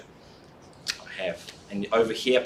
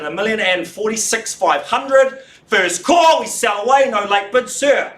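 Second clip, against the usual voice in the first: high, loud selling call; loud auctioneer call; 7 semitones higher, 4 dB louder than usual. Real, not acted. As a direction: push the room, calling it away. Auctioneer calling final bids.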